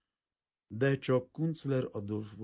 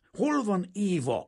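much higher, louder, slow bellow; roaring shout; 8 dB louder, slower than usual. A narrator's reading. Kazimír roars at the little bald father, full shout. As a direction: roar the question at him. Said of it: "ordított föl Kazimír".

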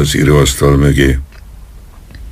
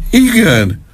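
high, broken admission; high desperate confession; pained confession; 9 semitones higher, slower than usual. A narrator's reading. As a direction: blurt it out to stop the pain. Colonel confesses in pain.

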